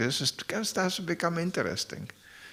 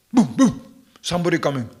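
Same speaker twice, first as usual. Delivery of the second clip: playful dog voice, louder and higher; dog's alert bark; 4 semitones higher, 6 dB louder than usual. Retold or imitated. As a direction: bark it out like an excited dog warning. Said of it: "the dog goes".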